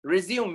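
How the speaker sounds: Resume said rih-ZYOOM; two-syllable Resume with silent final e; silent-e pronunciation. Shown as a demonstration. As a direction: say Resume like the verb. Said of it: "we don't say resume".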